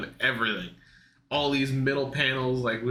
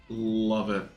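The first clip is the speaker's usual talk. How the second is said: usual speaking voice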